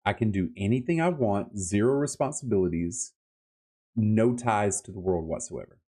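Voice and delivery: ordinary talk, nothing marked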